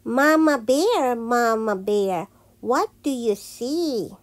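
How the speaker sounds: ordinary delivery, nothing marked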